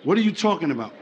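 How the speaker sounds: low, subdued puzzlement, acted; confused. Confused man to his woman, low puzzled question. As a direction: ask it low, as a baffled man. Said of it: "Men are very confused".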